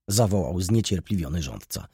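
plain reading voice

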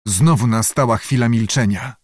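usual speaking voice

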